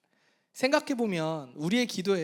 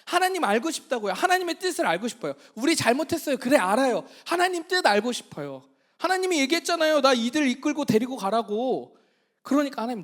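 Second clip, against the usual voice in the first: higher, louder begging; pleading Moses; 4 semitones higher, 5 dB louder than usual. Retold or imitated. Moses clinging to God, pleading higher and louder. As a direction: beg God not to leave, pressing hard. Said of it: "하나님 앞에 조른 거예요".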